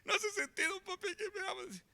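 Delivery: put-on high, far-off child voice, pleading; crying little boy. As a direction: play the small son protesting through tears. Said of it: "ya me hacía casi llorar porque como".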